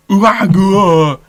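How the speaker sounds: loud, drawn-out wrestler roar; Tasmanian devil noise. Retold or imitated. Reading match notes with a wrestler's noise, Tasmanian devil style. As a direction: imitate a wrestler's guttural holler. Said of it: "he sounded like the Tasmanian devil".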